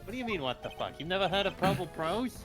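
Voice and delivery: normal talking voice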